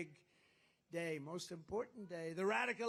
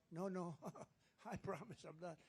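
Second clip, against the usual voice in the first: frightened guy; 9 dB quieter than usual. Acted. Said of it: "And this guy would go".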